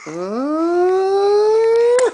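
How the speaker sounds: long rising smooch sound; long drawn-out kiss. Real, not acted. Father's long kiss sound for everybody watching. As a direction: stretch the kiss out high.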